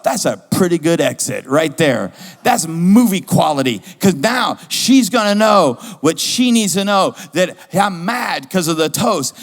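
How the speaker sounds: loud, slow, emphatic self-congratulation; retold indignant triumph. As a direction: proclaim it loudly, relishing the grand exit. Relives his triumphant angry exit.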